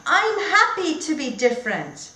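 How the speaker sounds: a hint of a contented little elephant; Alfred's words. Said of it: "when he says".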